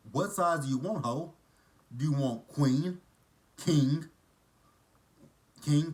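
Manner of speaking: loud, high, exasperated demanding; hammed-up fed-up rant; sassy telling-off. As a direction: rattle off the choices like an insult. Acted sassy telling-off of Victoria.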